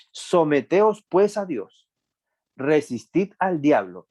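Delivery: slow, high-pitched verse proclamation; scripture declamation. A narrator's reading. Preacher reading Santiago to the congregation, slow and emphatic.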